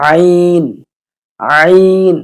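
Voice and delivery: "আইন" with a tight pharyngeal start, held long; from inside throat. Shown as a demonstration. Teacher modelling the letter, "আইন" from inside the throat. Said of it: "হলকের ভিতর থেকে".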